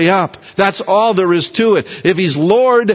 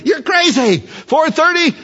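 incredulous friend; 10 semitones higher than usual. Acted. Friend mocks early prayer.

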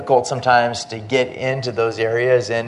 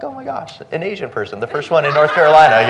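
high gasp building to louder excitement; mock amazement; 4 semitones higher, 4 dB louder, faster than usual. Voicing locals amazed at seeing him.

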